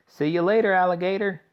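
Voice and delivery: sing-song it defiantly; cheeky shout. A narrator's reading. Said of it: "he shouted".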